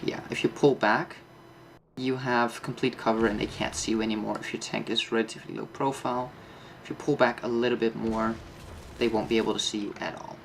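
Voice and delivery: ordinary talk, nothing marked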